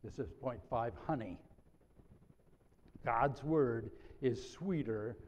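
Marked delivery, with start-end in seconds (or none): 1.1-1.4 honey set apart and dropped low and soft; honey as a soft, falling keyword; stress on honey. Shown as a demonstration. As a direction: linger on honey with a falling close. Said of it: "I want to emphasize the word".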